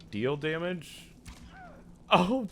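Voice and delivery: ordinary delivery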